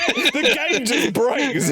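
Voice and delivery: high, laughing through words. Real, not acted. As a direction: crack up as you say it. Laughing at broken game.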